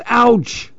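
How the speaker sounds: high little pained Ouch; mock ouch. Lil picturing a bed of rocks, mock Ouch. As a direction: yelp as if landing on rocks.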